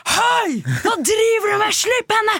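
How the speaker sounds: high, loud shouted command, retold; confronting the guards, shouting high. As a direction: yell at them to let her go. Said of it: "Og jeg roper".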